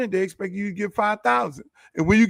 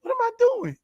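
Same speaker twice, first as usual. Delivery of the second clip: much higher, despairing mock voice; imitating exasperation. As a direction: squeak out the hopeless question.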